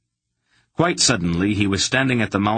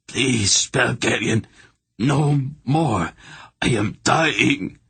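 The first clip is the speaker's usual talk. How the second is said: higher, strained plea trailing slow at the end; desperate pleading; 6 semitones higher, slower than usual. A narrator's reading. Tortured hierarch begging Garion to stop, desperate plea. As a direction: beg for your life in pain.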